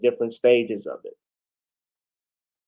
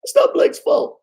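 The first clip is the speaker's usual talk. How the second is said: much higher, strained coach voice that half drops to a whisper; Doc Rivers impression; 5 dB louder than usual. Vlogger mimics the Clippers coach defending Blake, high and strained. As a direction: squeeze it up into a high, airy coach's voice. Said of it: "Doc Rivers".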